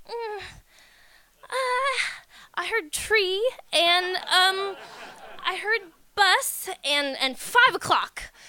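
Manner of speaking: halting unsure report; clueless guessing. Retold or imitated. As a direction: piece it together like you barely understood. Clueless teen reporting scraps.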